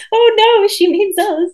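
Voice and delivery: high, loud mock horror; mock dismay. Imitating English audience realising the joke, high mock horror. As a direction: pretend to be caught out and alarmed. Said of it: "the room of English people going".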